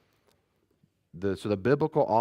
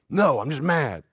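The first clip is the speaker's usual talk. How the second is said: mock angry kid; 6 semitones higher, 8 dB louder, slower than usual. Child insisting in retold talk.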